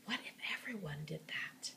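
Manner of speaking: hushed whisper, no voice. Acted. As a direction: shush the kids in a whisper. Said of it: "put her finger up to her lips".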